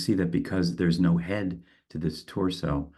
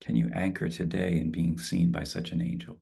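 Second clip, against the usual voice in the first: calm, spacious guided meditation. Acted. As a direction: ease them into stillness. Leads a closing meditation.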